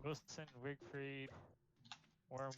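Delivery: slow deliberate name list. Real, not acted. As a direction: spell out each name slowly. Listing names slowly.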